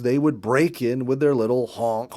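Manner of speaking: ordinary delivery, nothing marked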